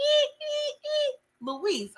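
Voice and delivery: high squeaky stutter on ee, ee; mock stammering squeal. Mocking Tommy's stammer, high squeaky stutter.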